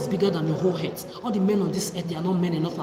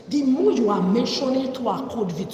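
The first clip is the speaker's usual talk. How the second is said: angry outburst; 5 semitones higher, 6 dB louder than usual. Real, not acted. Raging about men and covid.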